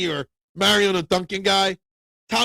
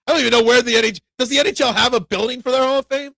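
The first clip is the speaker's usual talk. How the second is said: louder, higher mock disbelief; sarcastic; 6 semitones higher, 5 dB louder, faster than usual. Real, not acted. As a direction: lay on the sarcasm, mock puzzled. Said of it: "I'm being a little sarcastic there".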